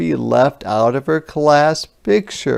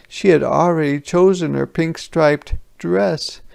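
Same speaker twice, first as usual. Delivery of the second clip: usual speaking voice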